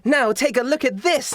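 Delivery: high-pitched showy reveal; theatrical showgirl voice; stage magician showman. Acted. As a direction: present the trick with a flourish, high. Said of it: "she then goes".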